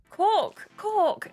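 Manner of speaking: put-on high-pitched Cork accent; name your hometown in an Irish lilt, high. Kelly tells islanders where she's from, in an Irish accent. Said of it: "learn how to do an Irish accent".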